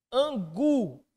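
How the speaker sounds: "angu" drawn out, landing hard on gu; stress on final syllable. Shown as a demonstration. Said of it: "qual que é a sílaba tônica". ("angu" com tônica forte no gu)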